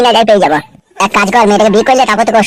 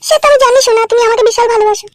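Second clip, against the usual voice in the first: much higher female voice, admiring; wife character; 11 semitones higher than usual. Acted. Wife praising husband's new shirt, high female voice. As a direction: play the doting wife, high-pitched.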